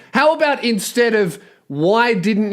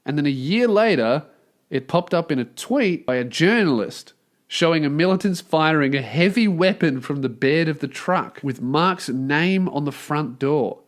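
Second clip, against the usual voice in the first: lower, even reading of the report; news article read; 4 semitones lower than usual. A narrator's reading. Host reads the news story to listeners, dry lower read. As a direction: read the lawsuit report straight.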